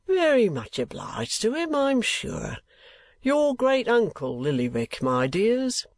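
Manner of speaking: high, slow, lordly explanation; father talking down to his daughters, condescending. A narrator's reading. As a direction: explain it down to the children grandly. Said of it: "interposed Mister Kenwigs, condescendingly explaining".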